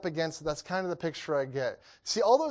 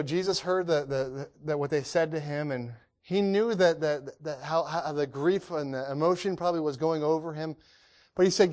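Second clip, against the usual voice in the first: ordinary talk, nothing marked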